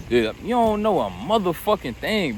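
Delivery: ordinary talk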